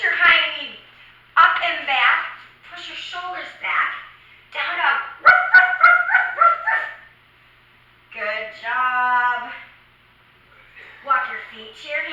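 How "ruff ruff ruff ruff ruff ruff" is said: high, loud yappy barks; puppy barking. Pretend. Teacher barking like a puppy in down dog, high loud yaps. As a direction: yap like a little puppy.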